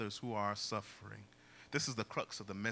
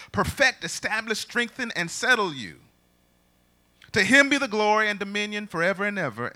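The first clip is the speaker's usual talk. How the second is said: loud, high, ringing scripture reading; preached scripture; 10 semitones higher, 14 dB louder than usual. Real, not acted. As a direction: proclaim the verse at full force, stretching words. Preaches scripture at full force.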